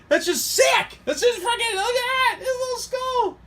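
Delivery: real excitement, voice shooting way up high; really excited. Real, not acted. Reviewer thrilled by the little skull, really excited. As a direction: squeal it in a very high voice. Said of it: "I get really excited. My voice goes up six octaves".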